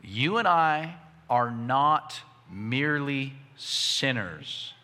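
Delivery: measured and spaced out, word by word; slow, deliberate emphasis. Real, not acted. Preacher tells congregation to hear this clearly, slow and deliberate. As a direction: say it slowly so every word lands. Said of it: "hear this clearly".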